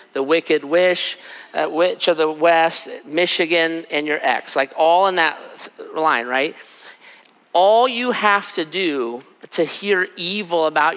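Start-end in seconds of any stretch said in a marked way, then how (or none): none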